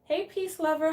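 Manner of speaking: ordinary talk, nothing marked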